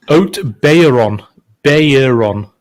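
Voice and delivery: "Bayron" sounded out slowly as BAY-er-on; careful pronunciation. Shown as a demonstration. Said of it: "how do you pronounce it?".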